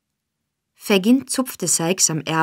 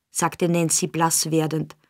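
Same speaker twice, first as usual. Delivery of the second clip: plain reading voice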